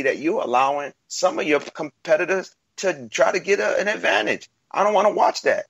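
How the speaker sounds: heated. Real, not acted. Heated argument about cheating.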